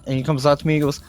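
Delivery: ordinary talk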